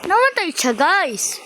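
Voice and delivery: high, loud exclamation to the viewers; real high-pitched yell; loud outburst. Real, not acted. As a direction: blurt it out too loudly. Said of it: "parang lakas ng boses ko dun".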